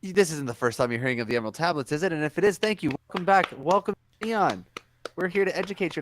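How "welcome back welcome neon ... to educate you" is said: upbeat host. Real, not acted. Host welcoming viewers.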